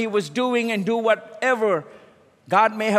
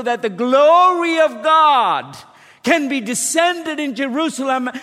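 rising, louder commanding divine voice; voice of God; 3 semitones higher, 4 dB louder than usual. Pretend. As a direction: call him to rise with growing force. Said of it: "he heard the voice of God saying".

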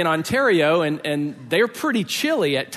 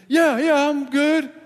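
much higher, louder call; yelled reply; 9 semitones higher, 4 dB louder, slower than usual. Retold or imitated. Friend yells back across the lake, higher and louder.

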